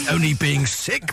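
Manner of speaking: ordinary talk, nothing marked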